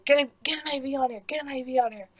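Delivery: lightly acted medical urgency; urgent orders. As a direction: imitate staff rapidly calling out orders. Acted staff calling out urgent orders.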